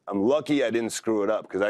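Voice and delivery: ordinary talk, nothing marked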